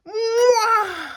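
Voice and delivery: high, loud wailing moan; big cartoon moan. A narrator's reading. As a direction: moan like a bed in agony. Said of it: "It moaned".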